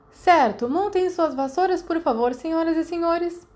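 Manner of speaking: commanding instructor call. A narrator's reading. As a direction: call out to the whole group like a flying teacher. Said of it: "Ruth berrou para o grupo".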